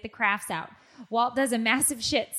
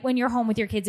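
normal talking voice

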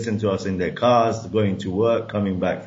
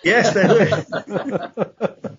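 slow, laughing answer; drawn out through laughter; 10 semitones higher, 3 dB louder, much slower than usual. Real, not acted. Laughs at the question.

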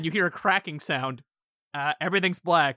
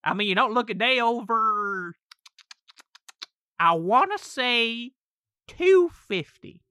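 teasing demon; 5 semitones higher, 4 dB louder, slower than usual. Acted. Demon teasing Derek about his age.

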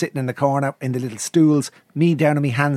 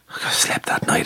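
ordinary talk

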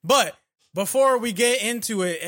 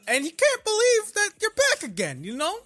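pitched way up, excited rambling host; mock hyped host, much higher; Joe Rogan impression; 11 semitones higher than usual. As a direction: suddenly leap high and ramble. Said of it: "That was my best Joe Rogan impression".

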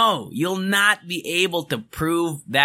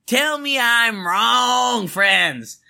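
drawn-out shouted Tell me I'm wrong, higher; 5 semitones higher, much slower than usual. Real, not acted. Challenging the podcast friends, a slow higher shout. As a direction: belt it out slowly to the listeners.

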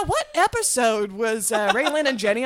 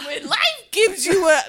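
high, goofy cartoon coach voice; Coach Steve; 8 semitones higher, 5 dB louder than usual. Starting a life motto for friends, Coach Steve impression. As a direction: pitch it up like a dopey coach. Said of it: "mine just sounds like Coach Steve".

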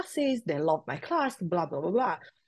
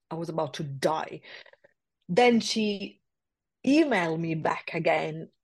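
ordinary talk

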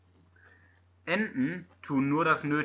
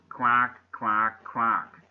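duck quacking; 4 semitones lower, 4 dB louder, much slower than usual. Retold or imitated. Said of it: "dann quaken sie".

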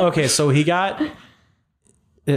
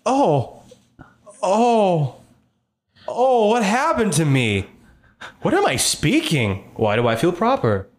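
bewildered revived creature; 2 semitones higher, much slower than usual. Acted. Game master as revived creature amazed at its own speech, bewildered. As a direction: sound out each discovery slowly, baffled.

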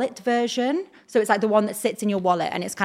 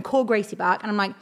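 normal talking voice

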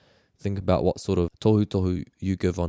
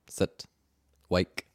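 usual speaking voice

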